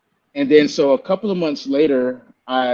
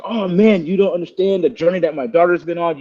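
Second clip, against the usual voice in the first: excited; 6 semitones higher, 3 dB louder than usual. Retold or imitated. Father Rick responds excitedly.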